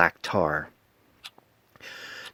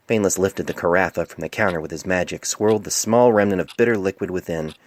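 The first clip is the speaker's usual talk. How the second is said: plain reading voice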